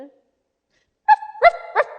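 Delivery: high, loud yapping barks; sharp dog barks. A narrator's reading. Dog answering Mary Poppins, yapping. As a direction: yap three times like a dog. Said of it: "barked sharply".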